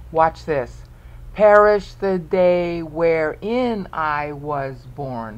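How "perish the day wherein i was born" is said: slow, emphatic verse, each syllable separate; counting out syllables. Shown as a demonstration. Teacher reads the other version to count, slow and deliberate. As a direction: read it slowly for the count, strong at the start. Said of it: "That's nine syllables".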